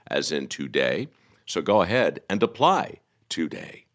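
upbeat sponsor read. Acted. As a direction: sell it like a radio ad. Reads a sponsor ad.

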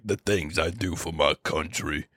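deep, slow gravel-free movie-hero voice; super low Vin Diesel. Retold or imitated. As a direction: drop way low and slow like an action star. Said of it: "his voice is, like, super low".

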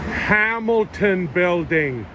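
slow drawn-out Canadian accent reading a sign. Shown as a demonstration. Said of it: "reading signs in my Canadian accent".

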